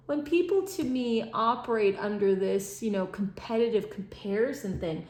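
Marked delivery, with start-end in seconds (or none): none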